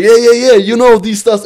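loud show-off bluster; hyped-up reply. Retold or imitated. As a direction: act all pumped up to match the threat. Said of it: "Ich geh auch ab".

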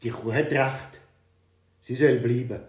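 lightly voiced king gives in; king relenting. A narrator's reading. (lauter, nachgebender König)

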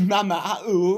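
high, loud mock French accent; mimicking French accent. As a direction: belt it out in a silly French accent.

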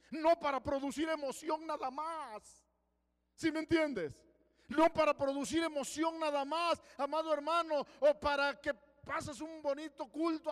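loud, high fiery preaching; preaching at full force. Acted. Pastor exhorting the congregation, loud fiery preaching. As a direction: drive it home from the pulpit.

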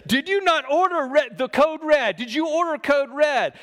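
high, loud courtroom yell; retold courtroom shout; yelling lawyer. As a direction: shout the demand at the witness. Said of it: "Tom Cruise is just yelling".

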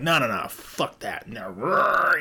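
worked-up refusal breaking into a growl; mock-furious sputter ending in a growl; livid, retold. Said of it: "I was livid pissed".

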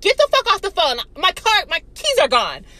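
agitated outburst. Retold or imitated. Teller cutting short a friend's phone call over lost keys, agitated outburst. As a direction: snap it out loudly, retelling the panic.

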